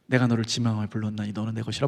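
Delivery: low, hushed divine voice; 하나님의 음성. Retold or imitated. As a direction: speak softly and low as God calling someone. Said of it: "하나님이 저에게 말씀하신다는 거예요". (낮고 조용한 하나님의 음성)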